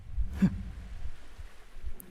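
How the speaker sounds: quiet little laugh under the breath; whispered laugh. Acted. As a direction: let a soft laugh slip out. Amused after teasing Ryder.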